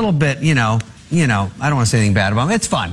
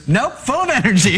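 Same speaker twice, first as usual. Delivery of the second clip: chirpy mom; 9 semitones higher than usual. Retold or imitated. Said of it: "And she goes".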